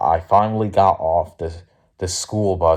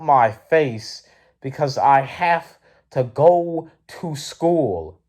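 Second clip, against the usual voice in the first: slow, higher, word-by-word insistence; exasperated retort; 7 semitones higher, slower than usual. Retold or imitated. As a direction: spell it out, fed up. Acted exasperated retort to the lady.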